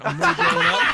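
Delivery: loud, high, laughing; laughing brag. Real, not acted. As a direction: brag jokingly through laughter.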